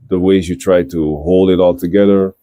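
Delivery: ordinary delivery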